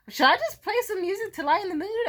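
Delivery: high-pitched, mock-innocent offer; tentative suggestion. To a shaken crowd, high tentative offer of music. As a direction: float the idea in a high voice.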